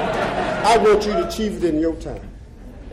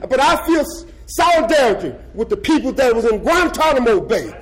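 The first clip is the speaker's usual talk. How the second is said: higher and louder, building to a shouted "stand up"; fiery rally speech; 9 semitones higher, 5 dB louder than usual. Real, not acted. Former death row prisoner rousing socialist comrades, fiery preaching. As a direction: whip the crowd up, voice climbing to a shout.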